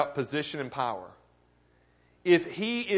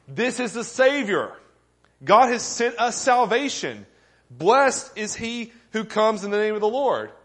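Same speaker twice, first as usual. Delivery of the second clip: fervent proclamation; 6 semitones higher, 5 dB louder than usual. Acted. Preacher proclaiming salvation.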